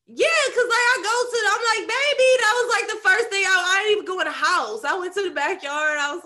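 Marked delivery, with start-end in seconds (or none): 1.9-2.3 high, loud call for a pet; retold calling out. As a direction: call out eagerly for your dog. Calling the dog on getting home.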